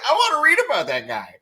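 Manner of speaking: high, quick burst of delight; excited. Retold or imitated. Host recalling first sight of a book cover, excited.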